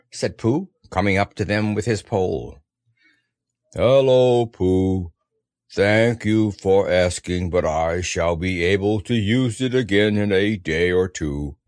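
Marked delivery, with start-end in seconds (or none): 3.7-11.5 slow, low, aggrieved drone; gloomy donkey. A narrator's reading. Eeyore thanks the boy who dries his tail, gloomy. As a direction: plod through it, low and mournful.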